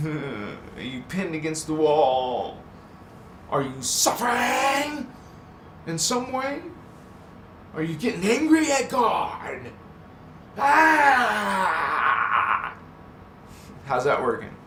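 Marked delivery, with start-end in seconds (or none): 3.5-9.6 mock taunt. Mock-taunting the chat viewers. 10.6-12.7 high, loud laughing yelp; laughing shout. Pretend. Taunting viewers, breaking into a shouted laugh.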